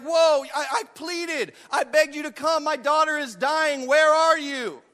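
high-pitched plea rising to a loud demand; acted desperate complaint to God; distraught protest. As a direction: protest in disbelief, pitched well up. Acts out abandoned pleader confronting God.

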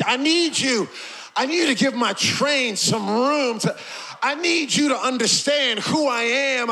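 high, urgent sermon cry; preaching at full force. Acted. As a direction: plead forcefully in God's voice.

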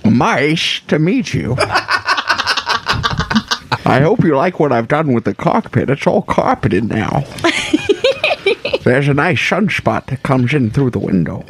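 put-on goofy old man voice; Adam Sandler-esque old man. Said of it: "sort of a Adam Sandler-esque old man voice".

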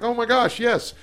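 light up as it clicks who he is; surprised recognition. Retold or imitated. Sudden recognition of old student.

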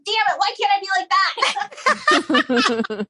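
high, slow, laughing mock despair; mock frustrated self. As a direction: whine it half-laughing at yourself. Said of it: "And I'm like".

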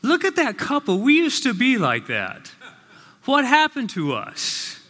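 wife's wistful voice. Retold or imitated. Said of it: "And the wife looks and says".

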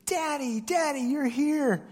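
put-on high, joyful child's cry of Daddy; excited child calling. A little daughter greets her dad at pickup, excited high call. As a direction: call out like a three-year-old seeing Dad.

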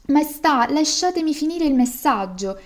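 high-pitched servant's protest; lightly acted urgent appeal; pleading. A narrator's reading. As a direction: beg the king to let you finish. Servant begging the angry king.

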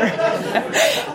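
airy laugh that pitches up; high breathy laugh. Real, not acted. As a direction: let a high giggle out. Laughing at her own story.

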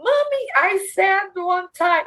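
mock exasperated child correcting mommy; impatient child. Daughter to her mother, imitated impatient child voice. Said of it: "they get impatient with me".

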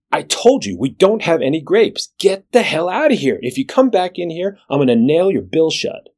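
hammed-up barroom threat to the duck; furious bartender. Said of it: "Bartender says".

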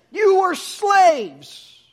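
very slow, high, loud stressed words; emphatic rebuke. Acted. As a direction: hammer each word home. Preacher repeats rebuke.

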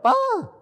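sudden high yelp; a sharp squeal of pain. Acted. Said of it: "she let out a yelp".

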